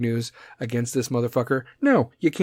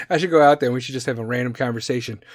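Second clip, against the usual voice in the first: ordinary delivery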